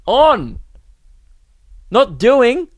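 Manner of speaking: high, slow disbelieving echo of the phrase; incredulous. Real, not acted. Comedian to audience, stunned by the stranger's phrase, high incredulous.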